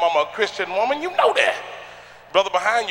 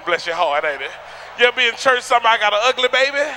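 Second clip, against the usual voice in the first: ordinary talk, nothing marked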